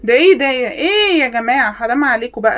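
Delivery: loud fed-up complaint; exasperated outcry. Real, not acted. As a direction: throw your hands up at them. (صرخة ضيق)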